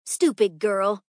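loud, cross rebuke; angry cry. A narrator's reading. Teacher blames the girl for spilled pills, angry cry. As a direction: snap loudly at the culprit. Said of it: "cried Mister Bernard".

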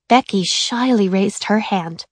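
ordinary delivery, nothing marked

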